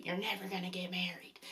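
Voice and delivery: hushed, breathy whisper; whispered warning. Retold or imitated. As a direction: drop to a whisper for the last blow. Retold warning, the final line.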